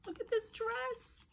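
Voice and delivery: very high, slow squeal of delight; real high-pitched gushing; awed delight. Real, not acted. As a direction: marvel in a high, drawn-out voice. Thrilled by tiny doll dress.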